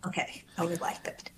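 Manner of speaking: unvoiced whisper. Real, not acted. Quiet aside to lawyer.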